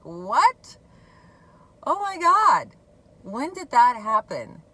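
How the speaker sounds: loud, high-pitched retold disbelief; astonished reaction. Retelling shock at the mother's new gratitude, astonished. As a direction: act out being floored, voice shooting up.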